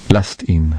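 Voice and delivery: ordinary delivery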